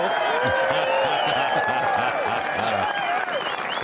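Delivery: loud happy laughter; delighted laugh. Real, not acted. Delighted laugh after shot.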